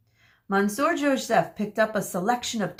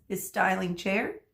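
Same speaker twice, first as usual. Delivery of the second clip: usual speaking voice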